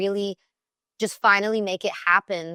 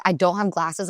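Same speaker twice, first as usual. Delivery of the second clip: usual speaking voice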